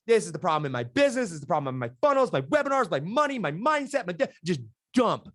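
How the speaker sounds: hurried, rising list of problems; dumping everything. Retold or imitated. Client to coach on first call, dumping everything. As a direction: unload problem after problem, pitch climbing. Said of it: "I am just dumping everything on you".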